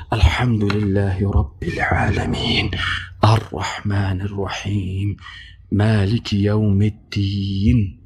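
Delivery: low near-whisper, slow, just audible to yourself; soft recitation. Shown as a demonstration. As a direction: murmur it so only your own ears hear. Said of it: "خودت بشنوی". (تلاوت آهسته که فقط خودت بشنوی)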